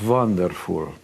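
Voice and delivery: put-on a light Hungarian accent on wonderful, the v for w; lightly imitating his Hungarian mother's accent. Son imitating his Hungarian mother's praise, light Hungarian accent. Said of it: "she said in her broken English".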